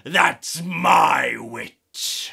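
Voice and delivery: loud, growling beast, dragged out slow; terrible monster voice. Acted. Monster scares off the dragon, loud slow growl. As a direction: roar it at the dragon. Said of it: "its terrible voice ... a growl".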